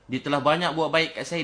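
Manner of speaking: normal talking voice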